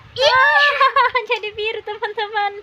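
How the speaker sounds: high, laughing and thrilled; laughing excitement. Real, not acted. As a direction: squeal it through a laugh. Blue tongue reveal.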